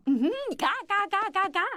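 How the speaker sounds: high quacking like a duck; quack, quack, high and silly. Pretend. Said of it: "模仿鸭子叫".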